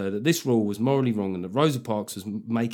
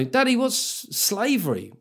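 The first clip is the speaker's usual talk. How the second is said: put-on higher, innocent kid asking; child asking; 9 semitones higher than usual.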